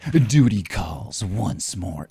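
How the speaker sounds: slow, low mock-heroic read; mock dramatic voice. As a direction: drag it out like a pompous hero. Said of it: "what was it".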